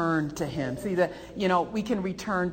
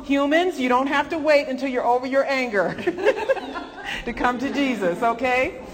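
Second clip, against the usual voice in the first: laughing announcement; 7 semitones higher, 6 dB louder than usual. Shown as a demonstration. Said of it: "that's the human announcement".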